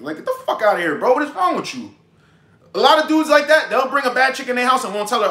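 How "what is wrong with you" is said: ordinary talk, nothing marked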